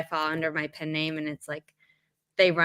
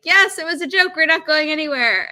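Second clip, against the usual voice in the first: cheery reveal; 8 semitones higher, 6 dB louder than usual. Retold or imitated. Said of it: "they eventually were like".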